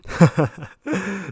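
a high short laugh; real amused laugh; giggle. Real, not acted. Laughs at own remark.

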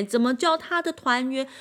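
usual speaking voice

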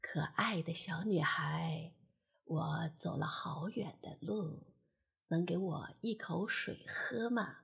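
low, soft-spoken poor woman; kindly. A narrator's reading. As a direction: ask gently, low and hushed. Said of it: "她和善地问". (穷女人低声和善地问)

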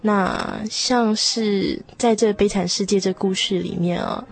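ordinary talk

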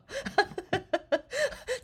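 high giggling laugh that rises. Real, not acted. Vlogger opens with a high rising laugh. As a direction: burst out laughing at your own intro.

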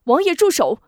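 high, loud cry to stop; sudden shout. Acted. Wu Zhong shouting at the prince to stop, loud high cry. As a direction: call out sharply to halt the prince. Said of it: "吴中突然喊声".

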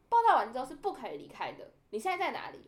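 loud, rising stern rebuke; imitating a fierce officer; fierce scolding. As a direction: lay down the rule harshly. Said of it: "超凶".

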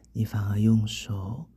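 ordinary delivery, nothing marked

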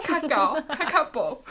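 较高 and 较薄 said out loud like a drilled pupil; repeating the lesson. Retold or imitated. Patient's uncle makes her repeat, loud pupil recital. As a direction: repeat 较高, 较薄 loudly after the teacher. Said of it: "教我念一摆".

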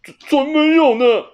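high, loud stammering denial; flustered. A narrator's reading. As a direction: caught out, stumbling over words. (慌张结巴的猫)

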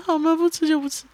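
high-pitched mock sulk; aggrieved little-kid voice; imitated wronged child. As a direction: play a kid giving in, hard done by. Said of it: "然后小孩就很委屈说".

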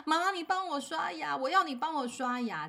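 imitating a child's high, pleading wail. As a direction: beg like a small boy clinging to mom. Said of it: "然后一直哭叫说".

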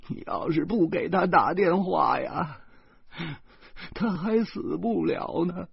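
grandma blaming the narrator through tears; reproachful old woman on the phone. Acted. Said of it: "这老太太竟然在电话里埋怨起我".

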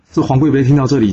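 ordinary delivery

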